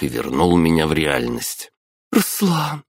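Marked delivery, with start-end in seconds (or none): 2.1-2.8 put-on much higher girl's exclaimed name; a young girl calling out in surprise; girl exclaiming. A narrator's reading. Girl exclaims the hero's name, high and sudden. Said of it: "воскликнула девушка".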